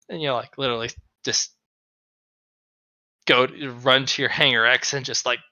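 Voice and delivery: ordinary delivery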